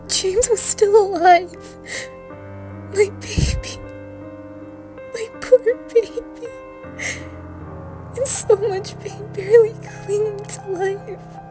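high, loud anguished cry of words; acted anguish. Parent seeing the tortured child still alive, anguished. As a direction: wail it out like a stricken parent.

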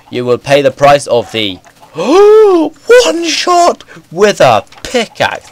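slow, high mock threat; villain voice. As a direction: growl it like a cartoon villain.

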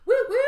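high, loud celebratory woo-woo; two big high cheers; excited whoop. Real, not acted. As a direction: whoop twice with delight.

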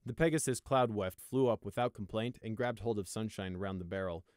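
ordinary delivery

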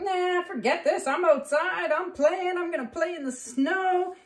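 high, cheeky voice for a runaway dog; defiant dog. Acted. Dog would rather play in the snow, defiant. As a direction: play a puppy refusing to come in. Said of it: "Echo's like".

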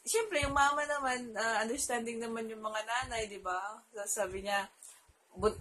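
usual speaking voice

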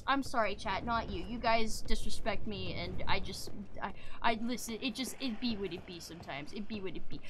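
low, soft, deflated mumbling; resigned, low-voiced apology; subdued apology. Real, not acted. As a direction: back down quietly after a rant. Apologises to chat after ranting.

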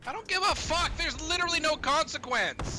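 high, loud defiant shout; defiant yelling. Real, not acted. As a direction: yell it back in defiance. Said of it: "Swear again".

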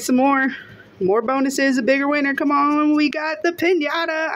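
real, mounting delight at a win; building excitement. Real, not acted. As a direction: pitch climbing into a thrilled exclamation. Hoped-for piñata turns up.

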